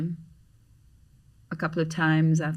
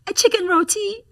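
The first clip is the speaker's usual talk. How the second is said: much higher, louder sing-song; chirpy order; 14 semitones higher, 6 dB louder than usual. Retold or imitated. Friend ordering lunch without the menu, chirpy. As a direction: order it like an eager kid. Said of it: "And he said".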